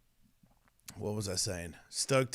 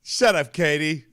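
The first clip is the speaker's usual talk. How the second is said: louder, half-joking shut-up; playful shout; 3 semitones higher, 9 dB louder than usual. Real, not acted. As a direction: shout it at her, louder, teasing.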